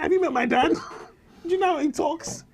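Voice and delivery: mock outrage at the producer; incredulous. Comedian retelling his shock at the producer's request. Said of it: "I said".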